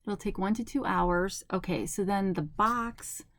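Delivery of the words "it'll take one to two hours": ordinary talk, nothing marked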